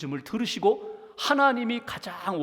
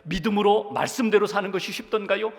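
ordinary talk

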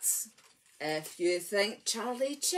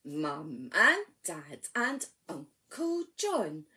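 knee rhyme; 4 semitones lower than usual. Acted. Leader reciting to babies on knees, hushed. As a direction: draw the little ones in.

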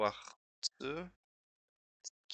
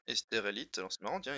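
ordinary talk, nothing marked

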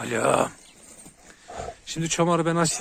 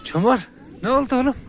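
calls the dog's name way up high, a bit louder; high pet-talk call; 10 semitones higher, 4 dB louder than usual. Real, not acted. Owner calling his dog Çomar in high baby talk.

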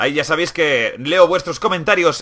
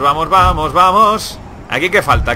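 ordinary delivery

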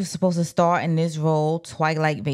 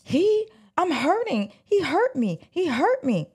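higher, pained imitation of a hurt victim; understated retold cry of pain, higher; mock distress; 10 semitones higher than usual. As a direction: voice her plea, pitched up, a touch of fear. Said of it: "but she said".

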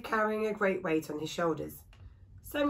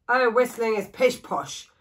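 dismissive, slow and louder grumble; gruff woodcarver; 4 semitones higher, 7 dB louder, slower than usual. Acted. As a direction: wave it away like a grumpy old hermit. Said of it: "said the wood carver gruffly".

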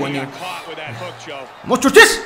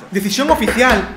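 ordinary talk, nothing marked

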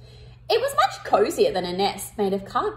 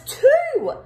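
cross; 5 dB louder than usual. Acted. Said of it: "he said crossly".

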